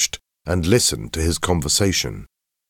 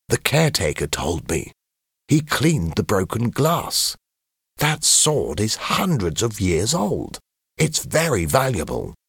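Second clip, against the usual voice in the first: lightly voiced boy, higher and a little hushed; hushed schoolboy explaining; 5 semitones higher than usual. A narrator's reading. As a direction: confide it to classmates during assembly. Mark explains during assembly.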